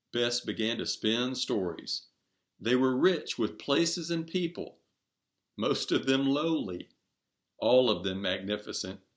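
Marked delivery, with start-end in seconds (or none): none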